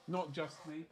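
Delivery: a faint afterthought echo; quiet echo. Shown as a demonstration. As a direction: echo yourself under your breath.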